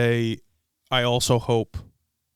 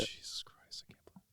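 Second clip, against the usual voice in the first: barely audible, hushed exclamation; whispered disbelief; 25 dB quieter than usual. Real, not acted. Host thinking over the nail polish story, disbelieving whisper.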